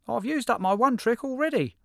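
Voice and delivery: very high, loud little critter voice; high squeaky hedgehog. Acted. Hedgehog answers jackal.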